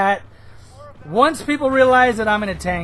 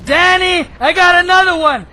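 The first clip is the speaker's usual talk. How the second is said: higher, louder triumphant shouts; excited whoop; 7 semitones higher, 5 dB louder than usual. Real, not acted. Gamer celebrating a plane kill to viewers, excited shouts.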